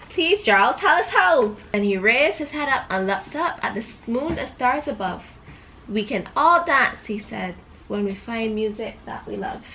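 put-on storybook voice for a little girl; reading to a child. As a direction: read it like a bedtime book. Reads picture book to child.

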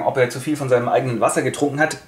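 ordinary talk

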